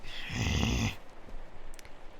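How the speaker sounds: long, low groaning sigh; exasperated sigh. Acted. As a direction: let out a drawn-out, annoyed low sigh. Reacting to being asked extra favours.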